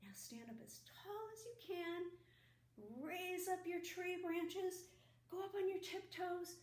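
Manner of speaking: energetic stretch leader; stretching cue. Acted. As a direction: rise up with the kids. Stretching instruction.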